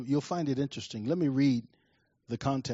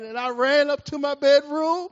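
high-pitched, full-voiced dramatic retelling; fired-up preacher storytelling; 12 semitones higher, 9 dB louder than usual. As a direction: tell it like a preacher building to the climax.

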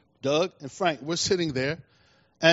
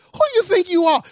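much higher, louder, near-crying challenge; crying rebuke; 10 semitones higher, 5 dB louder than usual. Retold or imitated. Pastor rebuking the false teacher at the conference, crying it out. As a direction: hurl the question at them in outrage.

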